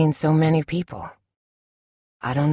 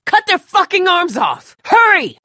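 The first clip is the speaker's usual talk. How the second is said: screaming orders in terror; panicked shout; 10 dB louder than usual. Acted. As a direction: yell it with everything you have. Fighting off walkers, panicked.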